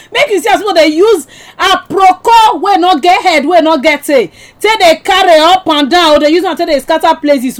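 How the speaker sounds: scolding. Real, not acted. Host scolding rumor spreaders.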